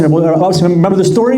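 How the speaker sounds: loud and high, eager on the phone; lady on the phone pressing the rabbi, calling out eagerly; eager caller. Retold or imitated. She calls out to remind him.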